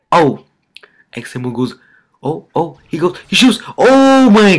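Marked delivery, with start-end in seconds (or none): none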